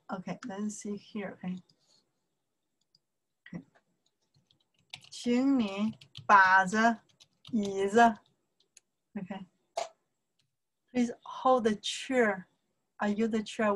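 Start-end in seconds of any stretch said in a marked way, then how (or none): 5.1-8.2 word-by-word teaching pace; slow, evenly spaced demonstration; slow model sentence. Shown as a demonstration. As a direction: draw out each syllable for learners to copy.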